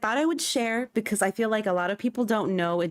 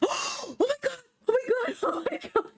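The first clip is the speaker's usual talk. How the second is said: gasping, very high-pitched disbelief; overwhelmed excitement. Real, not acted. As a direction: squeal it breathlessly, nearly crying with joy. Finds the hoped-for game character.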